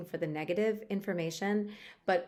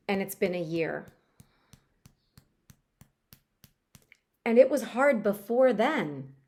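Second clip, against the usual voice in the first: tapping script. Acted. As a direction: speak each line for the listener to repeat. Reads a tapping script aloud.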